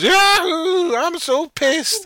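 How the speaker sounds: put-on high, loud drunken holler, drawn out; drunken tramp. Some tramp yelling about being drunk, hammed-up slur. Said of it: "Some tramp goes".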